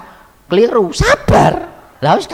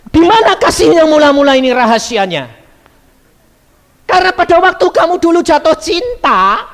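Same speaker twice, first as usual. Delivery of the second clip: loud, high fervent preaching; 14 semitones higher, 6 dB louder than usual. Acted. Preacher challenging the wives, loud and high. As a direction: drive it home to the women.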